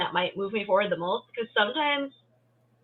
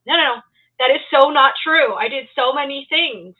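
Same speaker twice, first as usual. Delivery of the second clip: higher, louder mock protest; insistent, raised-voice rebuttal; emphatic self-correction; 6 semitones higher, 8 dB louder than usual. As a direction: argue back at your own doubts. Acted protest against own self-criticism.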